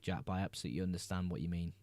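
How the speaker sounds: ordinary talk, nothing marked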